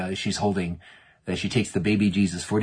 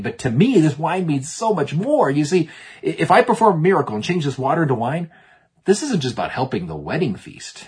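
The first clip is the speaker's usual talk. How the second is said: higher, louder, acted earnest appeal; impassioned explaining; 7 semitones higher, 4 dB louder than usual. Jesus explains the wine to his mother, impassioned. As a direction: press the point with rising fervour.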